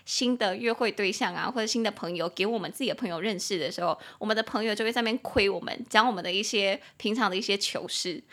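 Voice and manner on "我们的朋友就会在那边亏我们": ordinary talk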